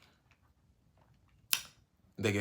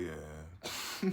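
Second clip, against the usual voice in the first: dazed low yeah; 7 semitones lower, 6 dB quieter than usual. Retold or imitated. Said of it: "I was like".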